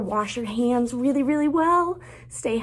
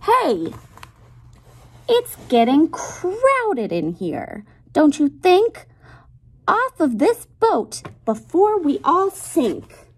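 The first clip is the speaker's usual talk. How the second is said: higher, louder pushy pig complaining about the crowd; bossy pig voice; 7 semitones higher, 4 dB louder, slower than usual. Acted. Pig in the story grumbling that the boat is too crowded, higher bossy voice. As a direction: play the pig shooing everyone off the boat.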